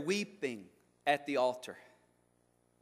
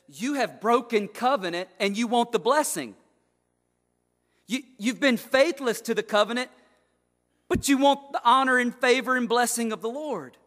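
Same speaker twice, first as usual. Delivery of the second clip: preach; 6 semitones higher, 6 dB louder than usual. Acted. God's rebuke preached.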